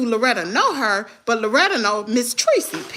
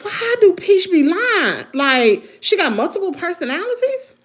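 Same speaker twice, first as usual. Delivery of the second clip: retelling her reaction to Peach, exasperated and higher; incredulous; 5 semitones higher than usual. Retold or imitated. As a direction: drag out the shock. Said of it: "so I'm like".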